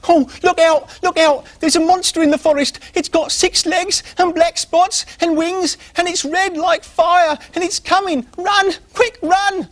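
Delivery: very high, loud terrified squirrel shout; frightened squirrel fleeing through the treetops, very high and loud; panicked squirrel. Acted. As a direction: scream a warning as you flee. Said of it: "He turned and he fled".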